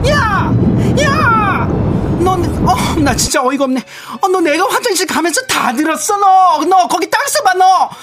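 high-pitched, explosive shrieking fury; acted rage. Overheard coworker confronting a backbiter, hammed-up furious yelling. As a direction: confront the two-faced backbiter at a screech. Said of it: "[name] 씨 분노 연기 잘하시네".

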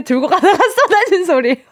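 real laughter breaking into the reading. Real, not acted. 사연을 읽다 웃음 터짐. (웃음을 못 참으며 읽기)

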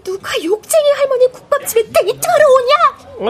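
exasperated. Acted. Scolding the boyfriend.

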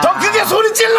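very high rousing shout; crowd hyping. Real, not acted. Urging children to scream louder. (높게 외치며 부추기기)

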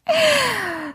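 a short, high laugh that falls away. Real, not acted. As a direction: laugh it off, caught out and amused. Amused at being called dialect.

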